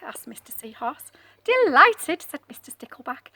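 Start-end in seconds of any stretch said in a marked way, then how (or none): 1.4-2.2 delighted fish. Acted. Cheerful fish greets seahorse.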